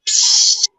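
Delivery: short hissing fart sound through the lips; mock fart noise. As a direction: puff out a little fart noise as a joke. Fart joke about laughing spirit.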